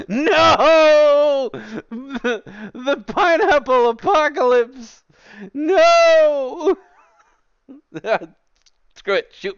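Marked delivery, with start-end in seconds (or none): 0.1-6.8 very high, loud, laughing wail of despair; mock anguished wail. Streamer wailing at chat over the pineapple apocalypse, mock despair. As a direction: ham up the end of the world.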